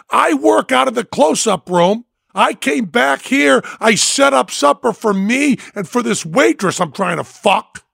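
high, indignant ranting; angry rant. Retold or imitated. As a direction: lay out the grievance, fuming. Biker rants about his dinner.

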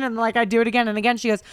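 usual speaking voice

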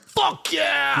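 high, cheering whoop; excited shout. Retold or imitated. Officials cheering for a spokesman.

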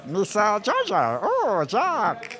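high, drawn-out cartoon creature voice; slow, high-pitched goofy impression; Jar Jar. Shown as a demonstration. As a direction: stretch it out high like a silly alien. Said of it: "I do a great Jar Jar impression".

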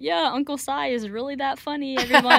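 sarcastic imitation of herself; mock fake cheer. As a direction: parody your own shallow stage talk. Mocking her own shallow Q&A.

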